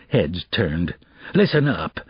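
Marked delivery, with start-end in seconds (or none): 1.3-2.0 growling. Acted. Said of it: "he growled".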